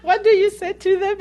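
asks it through high laughter; laughing question. Real, not acted. Teasing the guest about girls hanging up, laughing.

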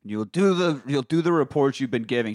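ordinary talk

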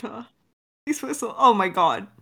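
ordinary delivery

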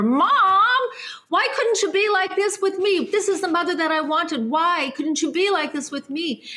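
high, anguished plea to mother; tearful plea. Retold or imitated. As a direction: choke up, pleading with Mom through tears. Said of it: "I started crying as I was walking".

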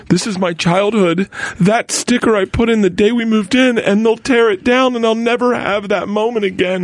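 imitating a daughter near crying, pitched high; high, plaintive child voice, drawn out; holding back tears. Daughter pleading with dad before demolition, holding back tears. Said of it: "[name] is about to cry".

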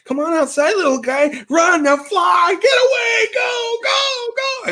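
very high, pleading shout; shooing the butterfly. Retold or imitated. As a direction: urgently coax a butterfly out the window. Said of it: "and I'm like".

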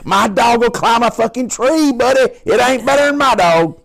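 hammed-up trucker bragging about his dog; mock trucker brag. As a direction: puff up and taunt over the CB.

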